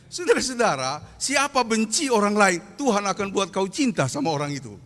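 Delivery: loud, rising sermon punchline to the congregation; fervent preaching. Acted. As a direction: proclaim it to the whole church. (seruan khotbah yang keras kepada jemaat)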